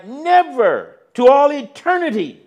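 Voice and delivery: high, loud, adamant never; emphatic refusal. Acted. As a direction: refuse with everything you have. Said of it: "his equally emphatic negative reply".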